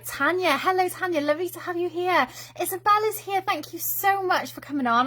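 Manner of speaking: high, delighted welcome to arriving viewers; excited greeting. Real, not acted. Greeting viewers as they join.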